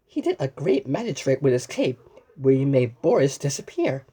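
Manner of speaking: plain reading voice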